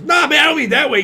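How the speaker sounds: high-pitched imitation of an excited driver; imitated driver. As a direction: hurry to reassure in a put-on high voice. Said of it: "He goes".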